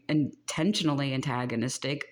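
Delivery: ordinary talk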